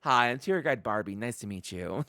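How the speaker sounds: lightly mimicked tour guide, low and soft; Tour Guide Barbie. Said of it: "thick European accent to, like".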